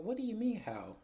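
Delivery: a high, exasperated what do you mean; incredulous. Retold or imitated. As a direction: ask it like he's lost his mind. She and Leslie react in disbelief.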